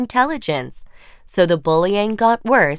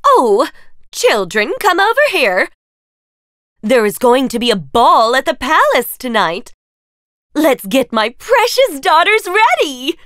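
loud, higher, fussing over daughters; gushing stepmother; 5 semitones higher, 5 dB louder than usual. Acted. Stepmother calling her daughters about the palace ball, loud and gushing. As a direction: call the girls in, thrilled.

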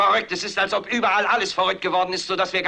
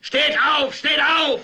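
shouted command; 9 semitones higher, 4 dB louder, much slower than usual. Acted. Anchor commanding viewers to rise.

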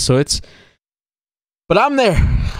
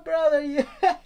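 laughing; 11 semitones higher, 8 dB quieter than usual. Real, not acted. Laughing reaction to scene.

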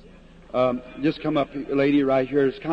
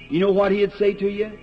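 ordinary talk, nothing marked

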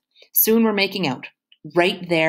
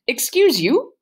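talking through peanut butter; indignant with a full mouth; 10 semitones higher, 5 dB louder, slower than usual. Acted. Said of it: "I say with a mouthful of peanut butter".

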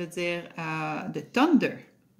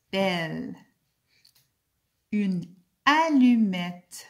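slow, careful word-list reading, each word set apart; slow dictation; 3 dB louder, much slower than usual. Acted. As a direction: read each word clearly for young learners, pausing between them. Teacher reads word list.

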